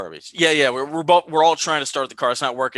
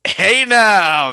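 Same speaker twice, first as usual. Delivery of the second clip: loud, high mock warning; teasing shout; 8 semitones higher, 10 dB louder than usual. Real, not acted. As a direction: call it out like a playful ref. Teasing reaction to joke.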